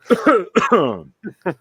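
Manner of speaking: loud rasping cough; throat clearing cough. Real, not acted. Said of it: "clear my throat".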